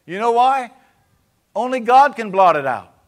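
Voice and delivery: loud, high, impassioned preaching; full-force preaching. Acted. Pastor fires up the church about sin and mercy, loud high preaching. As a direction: build it to a shouting climax.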